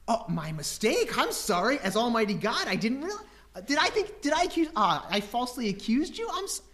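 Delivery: high mock-apologetic sarcasm; sarcastic apology. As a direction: pretend to grovel, dripping sarcasm. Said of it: "sarcastic God apology".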